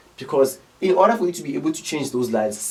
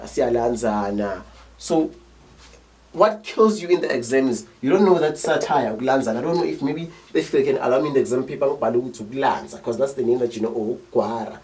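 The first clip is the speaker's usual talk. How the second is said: usual speaking voice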